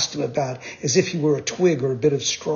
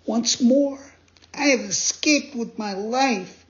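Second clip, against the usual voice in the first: high, youthful puppet cry of relief; Pinocchio voice; 9 semitones higher, slower than usual. Acted. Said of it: "he jumped to his feet and cried".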